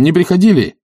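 high, pressing question. A narrator's reading. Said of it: "спросил он".

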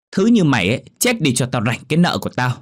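high, loud hateful yell; shouting. Acted. Daughter yelling at her mother with a broom, loud and hateful. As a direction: spit the curse out as a shout. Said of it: "mà quát".

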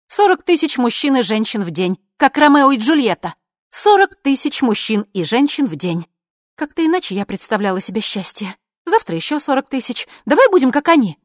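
high, insistent woman's voice; agitated high-pitched character voice; spiteful Juliet. A narrator's reading. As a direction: rant on high and bitterly.

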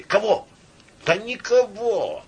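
high calling back and forth; crowd shouts. A narrator's reading. Bystanders arguing over who was caught, calling out. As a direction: throw the answer over the crowd.

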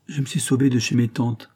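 ordinary delivery

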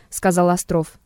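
plain reading voice

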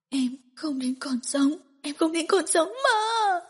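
despairing wail; loud crying. Acted. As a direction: break down sobbing. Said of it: "Diệu Tinh khóc lớn".

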